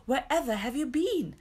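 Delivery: mildly scolding demand; indignant. A narrator's reading. Peter questions the returned children, indignant. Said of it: "asked Peter indignantly".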